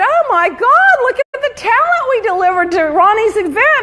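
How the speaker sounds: high, thrilled exclamation; imitated excited gushing. Friend quoted after a sold-out live show, excited. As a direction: gush about it, pitch way up. Quoting Jeff's delight at the show.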